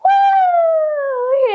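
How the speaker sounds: high squeaky woo; a tiny mouse whooping. Pretend. Teacher voices the mouse whooping up the clock. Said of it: "The mouse said".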